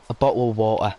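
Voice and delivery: slow, hammed-up British caricature; exaggerated British accent, drawn-out words; mock British accent. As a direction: drag out a ridiculous British accent. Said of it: "nobody I know speaks like this".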